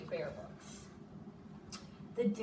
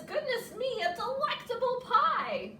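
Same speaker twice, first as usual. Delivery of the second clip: high, louder delighted gush; thrilled bear, much higher; 14 semitones higher, 6 dB louder than usual. Acted. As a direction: gush over the pie.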